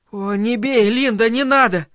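high, urgent begging; frightened plea. A narrator's reading. Boy shields his face from his mother, frightened plea. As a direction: plead not to be hit, pitched well up.

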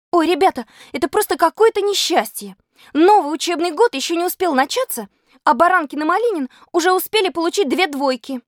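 put-on high-pitched girl crying out to classmates; dismayed cry. A narrator's reading. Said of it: "Зинка Фокина закричала".

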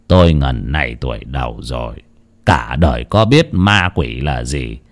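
low, soft, weary husband's voice; sighing husband. Acted. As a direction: say it low and subdued, as if after a long sigh. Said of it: "thở dài". (giọng ông chồng trầm, nhỏ, thở dài ngao ngán)

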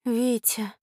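ordinary delivery, nothing marked

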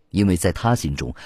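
ordinary delivery, nothing marked

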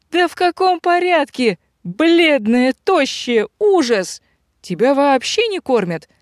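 high-pitched, scandalised exclaiming; appalled fussing. A narrator's reading. Robber woman fretting over her thin friend, appalled. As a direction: fuss in high, punched-out bursts.

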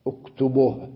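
اكتبه as uktubuh, breathed final h; pause with vowel transfer. Shown as a demonstration. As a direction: put u on the ب of اكتبه, silence the ending. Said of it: "لاحظ كيف ضممت الباء".

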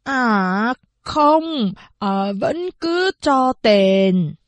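slow, halting foreign-accented Chinese, lightly voiced; a foreign countess fumbling her Chinese words slowly; broken foreign Chinese. Countess insists on paying the mother, in halting foreign-accented Chinese. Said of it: "ngọng nghịu nói bằng tiếng Trung Quốc".

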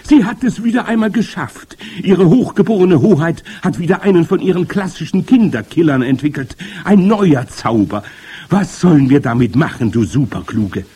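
loud, high adoring clamour; excited witch followers crying out; cheering witches. A narrator's reading. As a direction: gush over your leader at full volume. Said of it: "johlten sie".

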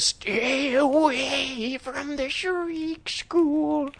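high, drawn-out spooky wail; ghostly warning. Retold or imitated. Ghost warning a boy off the piano school, eerie high wail. Ghost warns Jerry in retold story.

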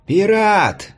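high drawn-out arena herald cry; herald calling out. A narrator's reading. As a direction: announce the fighter to the whole arena. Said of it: "закричал глашатай".

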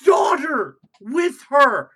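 slow, loud outraged shout; outraged disbelief. Real, not acted. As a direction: spit it out in disbelief.

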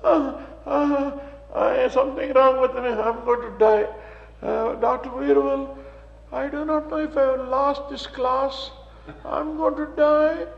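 high, fretful mock panic; imitating a scared Mrs Newsom; frightened student. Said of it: "comes on her lips".

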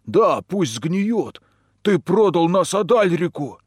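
slow, loud furious yell; angry shouting. A narrator's reading. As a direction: bellow it at the prisoner.

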